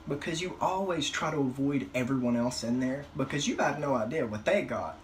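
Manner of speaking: normal talking voice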